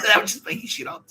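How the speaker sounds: high loud indignant retelling; outraged retelling. Retold or imitated. Telling the mate about the fake typos, indignant. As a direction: blurt it in indignation.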